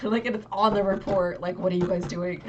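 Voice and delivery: mock-angry demand; retold anger. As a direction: replay telling them off, half in jest. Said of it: "I was so angry".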